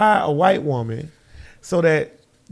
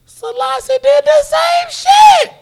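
shouting; 9 dB louder, slower than usual. Real, not acted. Heated rant about Selassie.

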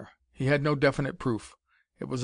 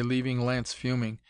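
plain reading voice

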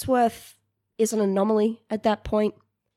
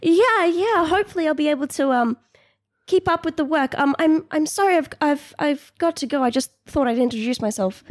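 higher, halting, polite employee; pitched-up, hesitant phone voice; nervous phone manner; 10 semitones higher, 5 dB louder than usual. Acted. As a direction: excuse yourself awkwardly from the call.

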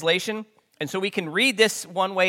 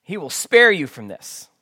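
emphatic Peter; 4 semitones higher, 10 dB louder than usual. Retold or imitated. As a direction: push back hard like a loyal friend. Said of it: "And Peter says".

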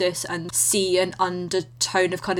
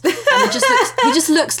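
laughing; 5 dB louder than usual. Real, not acted. Giggling while describing Charlie Hunnam.

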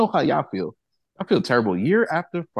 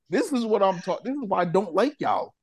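higher-pitched mock complaint; whiny retold outrage, pitched up; indignant rant; 7 semitones higher than usual. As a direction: replay the outraged complaint to the fan. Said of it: "I'm like".

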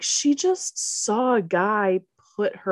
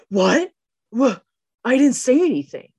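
louder, higher baffled protest; mock startled; 5 semitones higher, 6 dB louder than usual. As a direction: act out a startled, confused denial. Acted startled reply to mind reading.

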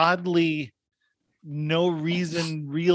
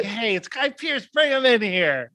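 higher-pitched mock hail; acted calling out; 8 semitones higher, 3 dB louder than usual. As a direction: call out as if flagging someone down. Acting out people hailing a passerby.

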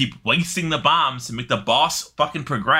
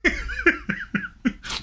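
high laugh; 4 dB quieter than usual. Real, not acted. Laughing at own impression.

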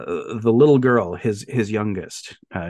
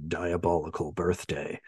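low, softer sinister subtitle reveal; mock-ominous title; 6 semitones lower, 9 dB quieter than usual. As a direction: drop low and quiet for a horror title. Dramatic reading of sequel title.

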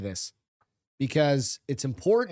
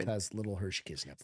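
quiet; 6 semitones lower, 13 dB quieter, faster than usual. Real, not acted. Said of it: "teasing repeat".

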